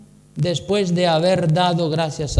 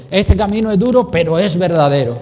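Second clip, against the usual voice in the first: ordinary talk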